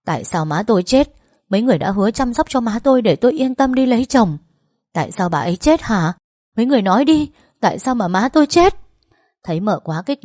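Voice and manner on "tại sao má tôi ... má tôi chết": raised, high-pitched outburst building in force; angry shouting. A narrator's reading. Daughter confronting the in-laws over her mother's death, angry shouting. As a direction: demand answers in a loud, accusing yell. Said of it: "xông đến quát họ".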